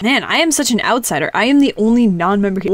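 usual speaking voice

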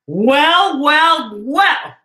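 a lightly hammed-up storyteller's reaction; mock-knowing well well well. Narrator reacts after the story.

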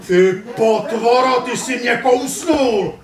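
high, loud, drawn-out scolding; angry outburst. Acted. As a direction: yell in outrage at being bitten. Kousnutá postava nadává útočníkovi. (rozzlobený výkřik)

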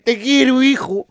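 agudo y fuerte, efusión de borracho; suéltalo como un familiar borracho y efusivo; cariño de borracho. Acted. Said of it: "Con alcohol hasta te dicen". (cariño de borracho, exagerado y en voz alta)

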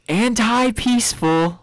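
high, drawn-out mock yell; mock scream. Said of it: "Somebody just screams".

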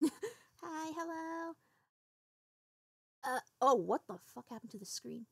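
usual speaking voice

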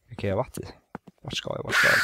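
usual speaking voice

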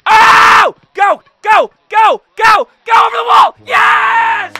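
high screamed urging, then triumph; real full-throated shouting; frantic cheering. Real, not acted. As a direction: scream the ball over the wall. Screams walk-off ball over wall.

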